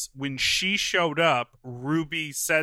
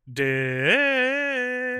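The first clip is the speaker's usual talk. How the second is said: playful emphasis; 9 semitones higher than usual. Acted. Echoing word for comedy.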